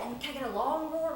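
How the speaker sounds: ordinary delivery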